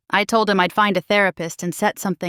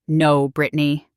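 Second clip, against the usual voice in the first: firmly; 5 semitones lower than usual. Acted. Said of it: "he said firmly".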